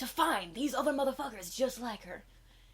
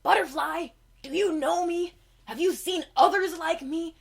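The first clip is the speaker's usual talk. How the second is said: much higher, louder eager plea; loud, high-pitched unicorn begging; 10 semitones higher, 8 dB louder than usual. Acted. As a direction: call out to him, high and desperate. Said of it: "she tries asking him".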